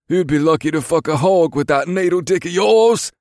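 high, loud mocking soldier; scornful jeer. A narrator's reading. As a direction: jeer at his manhood loudly.

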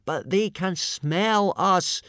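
high, slow urgent shout. A narrator's reading. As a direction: cry out the warning, stretched and high. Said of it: "shouted Hiccup".

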